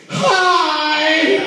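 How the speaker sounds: shrill jump way up on "Hi!"; sudden high-pitched hi. Shown as a demonstration. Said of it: "look at them dead in their face and go".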